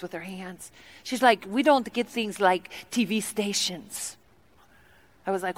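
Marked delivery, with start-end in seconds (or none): 1.5-4.2 soft, slow, badly faked Congolese accent; mock Congolese accent. Tea lady explains home to guest, slow mock Congolese accent. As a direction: spell it out slowly in a Congolese accent. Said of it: "her accent sounded nothing like this".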